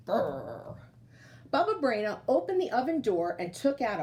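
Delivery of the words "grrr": grumbling bear. Acted. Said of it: "Old Bear snuffled and grumbled".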